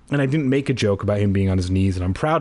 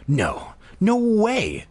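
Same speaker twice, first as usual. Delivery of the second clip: much higher, slow shrill protest; 10 semitones higher, slower than usual. Acted. Pinned [name] repeats his refusal to Keith, shrill and slow. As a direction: squeal it in fright. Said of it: "I repeated shrilly".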